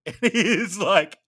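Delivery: high, loud laughter through the words; laughing. Real, not acted. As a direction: crack up mid-story. Laughing while telling the story.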